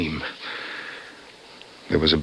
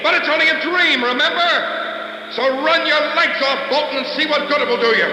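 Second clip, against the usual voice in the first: mocking shout. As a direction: throw his own words back at him. Kingman shouts mockingly from car.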